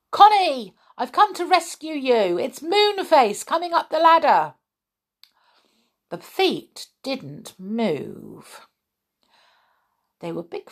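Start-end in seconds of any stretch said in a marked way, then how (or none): 0.1-4.5 high, loud calling yell. A narrator's reading. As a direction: call up the ladder loudly. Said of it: "he gave a yell".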